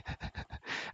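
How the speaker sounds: short breathy whispered laugh; quick hushed giggle. Real, not acted. Laughs while showing the table.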